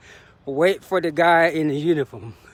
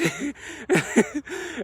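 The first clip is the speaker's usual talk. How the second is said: real laugh; 10 semitones higher than usual. Real, not acted. Runner still amused by their own talk, high giggle. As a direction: keep giggling between phrases.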